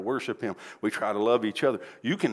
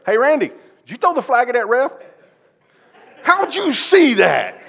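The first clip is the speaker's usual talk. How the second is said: teasing parents; 12 semitones higher, 12 dB louder than usual. Retold or imitated. Parents tease pastor about the thrown flag, high loud teasing call. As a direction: call out to him like amused team parents.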